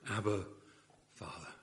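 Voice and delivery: soft, intimate call to a father; hushed tender cry. Acted. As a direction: breathe it like a child to its father.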